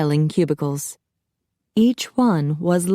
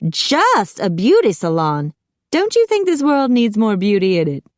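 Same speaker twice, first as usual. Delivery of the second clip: higher, affronted and theatrical; appalled; 4 semitones higher, 4 dB louder than usual. A narrator's reading. Macy spins round at the slight, appalled. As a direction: echo it back in dramatic offense. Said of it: "with an appalled expression".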